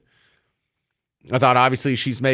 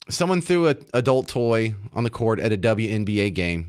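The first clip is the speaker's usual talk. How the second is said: ordinary talk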